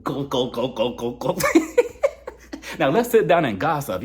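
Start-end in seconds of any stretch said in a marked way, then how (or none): 0.0-1.4 low, rough pretend order, much lower and softer; pretend brusque demand. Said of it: "I would've just told the man". 1.4-2.3 high burst of real laughter. Real, not acted. Laughing at own joke.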